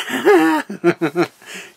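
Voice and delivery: high, loud happy laughter; giddy laugh. Real, not acted. Newlywed laughing after announcing her wedding, delighted.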